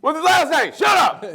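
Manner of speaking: high-pitched calling out; mock demand. Heckler pressing announcer for the surname, mock shout. Acted crowd and announcer exchange.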